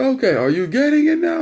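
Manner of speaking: high, loud mock impatience; impatient dad. Dad checking son finally gets it, impatient.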